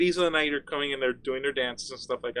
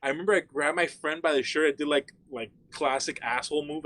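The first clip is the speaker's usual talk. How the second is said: ordinary talk, nothing marked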